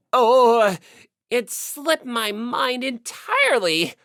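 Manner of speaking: overly formal and flustered; pompous otaku. Acted. Yamada answering Naegi from the computer, loud pompous otaku voice.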